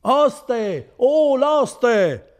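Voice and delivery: loud, high, long-held shout; calling out. Acted. Renzo in bed calling the innkeeper, shouting. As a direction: holler through the house for help.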